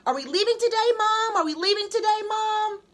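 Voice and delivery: put-on high, loud, eager child begging; a kid pestering to leave now; eager asking. A narrator's reading. Child nagging mother about the trip, eager and loud. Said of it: "every day he asked his mother".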